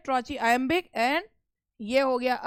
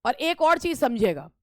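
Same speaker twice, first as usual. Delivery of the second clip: usual speaking voice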